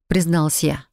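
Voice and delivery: plain reading voice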